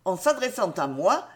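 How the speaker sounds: plain reading voice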